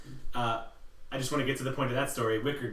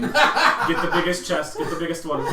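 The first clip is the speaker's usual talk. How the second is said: eager halfling; 3 semitones higher, 7 dB louder than usual. Acted. Player eggs on the halfling, loud and laughing. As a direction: urge the halfling on greedily, laughing.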